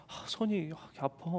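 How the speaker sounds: quiet complaint. Retold or imitated. Wife complaining of sore hand.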